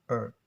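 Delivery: "herb" as erb, starting on the vowel; American silent H. Shown as a demonstration. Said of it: "is silent".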